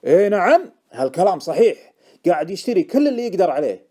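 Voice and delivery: worked up. A narrator's reading. Informant confirming his tip to Deacon, worked up. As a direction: insist loudly and excitedly. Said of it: "أثار راعي المعلومة".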